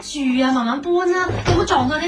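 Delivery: calling out to movers. Acted. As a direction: shout warnings across the shop. Shouting orders at movers.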